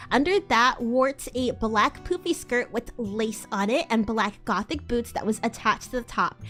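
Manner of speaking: normal talking voice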